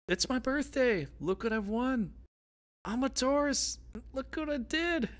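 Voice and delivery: high-pitched, excited bragging yell; rooftop shouting. Acted. Imagined birthday person bragging from the rooftops, shouting. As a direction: crow it out for all to hear. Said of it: "Maybe you're shouting on the rooftops".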